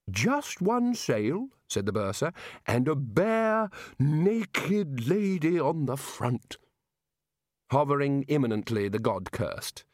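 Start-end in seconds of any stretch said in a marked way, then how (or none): 0.1-1.5 high, very slow, dotty voice; addled bursar. Acted. Muddled bursar correcting Ridcully, high and dreamy. 2.7-6.6 higher, drawn-out dreamy voice; addled bursar. Acted. Muddled bursar describing a figurehead, slow and dotty.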